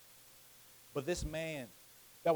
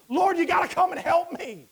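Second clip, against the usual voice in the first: high, loud desperate plea; begging cry; 11 semitones higher, 11 dB louder than usual. Acted. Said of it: "He begs him greatly, saying".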